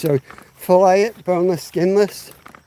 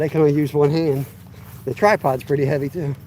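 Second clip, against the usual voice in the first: ordinary talk, nothing marked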